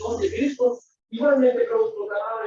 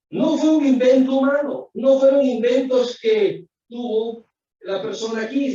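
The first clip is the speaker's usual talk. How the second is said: raised, louder voice to insist; emphatic preaching; 5 semitones higher, 5 dB louder than usual. Real, not acted. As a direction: proclaim it firmly to the congregation. Preacher insists word isn't human. (predicando con énfasis y más fuerte)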